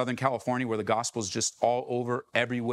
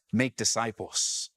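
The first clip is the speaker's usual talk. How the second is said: "Make disciples" slow and lower, each word weighted; stress on make disciples; 5 semitones lower, slower than usual. Shown as a demonstration. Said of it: "the emphasis is on the words".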